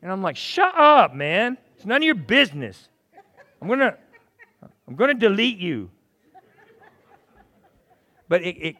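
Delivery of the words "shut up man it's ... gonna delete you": loud, high comic outburst; mock indignant retort. Man telling off his nagging phone app, mock indignant. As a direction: snap back in mock outrage.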